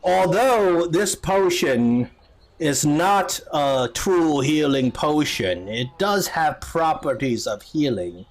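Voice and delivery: slow, deliberate judge; stately courtroom summary, stretched words; judge summing up. Acted. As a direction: deliver a measured ruling from the bench. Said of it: "the judge kinda summarized the case".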